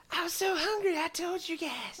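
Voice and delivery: high, squeaky little creature voice; hungry pet creature. Acted. Pet Kokolo answering if it's still hungry, high creature voice.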